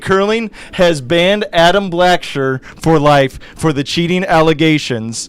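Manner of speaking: loud, excited sportscaster bulletin; dramatic news announcement. Real, not acted. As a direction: proclaim the shock verdict to listeners. Announces ban like breaking news.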